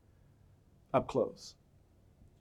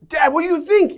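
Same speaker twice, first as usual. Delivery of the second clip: put-on higher and louder, hopeful young voice; eager son; 8 semitones higher, 6 dB louder than usual. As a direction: play the son seeking approval. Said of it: "and he goes".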